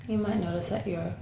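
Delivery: unhurried meditation guide, long pauses between phrases; guided meditation. Acted. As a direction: guide them gently inward, leaving space after each phrase.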